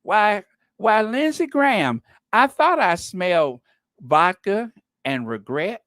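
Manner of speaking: sass it like a Southern gossip; Leslie Jordan. Retold or imitated. Leslie Jordan teasing the senator about vodka. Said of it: "love for Leslie Jordan to say that line".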